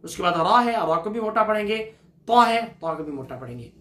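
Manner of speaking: ordinary talk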